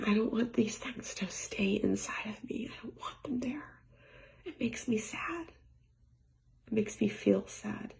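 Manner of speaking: choked-up whisper, close to tears; real tears behind a whisper; tearful whisper. Real, not acted. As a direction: let the voice break into a teary whisper. Emotional vlog about sadness.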